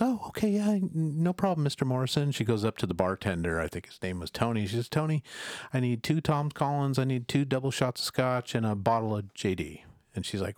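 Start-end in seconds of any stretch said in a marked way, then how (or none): 0.0-2.3 high, nervous eager-to-please voice; flustered young waitress. Retold or imitated. 4.9-9.8 lightly raised eager voice; hint of the waitress. Waitress voiced ordering at bar.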